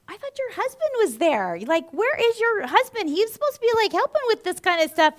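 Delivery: high, incredulous retold complaint; indignant nurse. Nurse scolding absent husband, acted.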